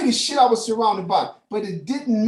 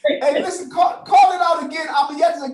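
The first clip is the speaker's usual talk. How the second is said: real shout; 6 semitones higher, 3 dB louder than usual. Real, not acted. Calling across the classroom.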